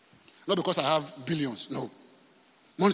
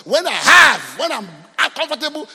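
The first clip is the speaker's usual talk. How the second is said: loud, raised-pitch mock declaration; emphatic shout; 8 semitones higher, 12 dB louder than usual. Preacher voicing people waiting for God, loud high shout. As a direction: blast out the excuse, high and loud.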